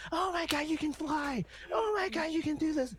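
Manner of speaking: shrill, high, amazed anime bystander; overacted anime dub. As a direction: overact the stunned crowd in a cheesy dub. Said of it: "and they're just like".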